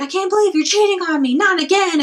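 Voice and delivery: high-pitched fake jealous girlfriend; mock freak-out. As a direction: act betrayed, voice up high. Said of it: "freak out and be like".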